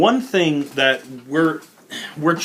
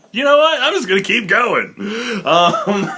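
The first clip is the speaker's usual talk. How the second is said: high, louder laughing talk; 8 semitones higher, 3 dB louder than usual. Real, not acted. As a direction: laugh it off, giggling. Laughs after coughing fit.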